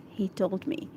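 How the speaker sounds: ordinary delivery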